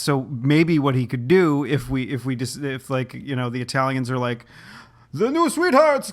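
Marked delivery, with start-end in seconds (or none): 5.1-6.1 high, hammed-up mock Italian accent. Italian fans hailing the band in a mock Italian accent. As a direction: belt it out like an excited Italian fan. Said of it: "that's my Italian accent".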